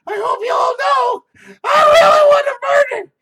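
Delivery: squealing high shouted voice; hammed-up shrieking maniac; mock killer voice.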